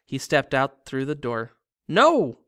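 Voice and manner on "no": high, loud horrified shout; girl crying out in horror. A narrator's reading. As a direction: cry out as he jumps. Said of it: "Violet cried".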